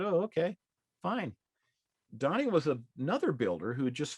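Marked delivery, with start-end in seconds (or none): 0.0-1.4 high, hesitant go-along; reluctant agreement. Retold or imitated. Donnie shrugging off his buddy John's pushy advice, reluctant. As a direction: give in to your pushy friend.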